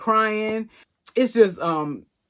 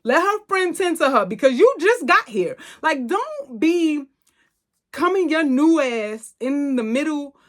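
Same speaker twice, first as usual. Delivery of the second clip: indignant rant; 8 semitones higher than usual. Real, not acted. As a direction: tell her off like she crossed a line. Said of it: "that girl just irritated my soul".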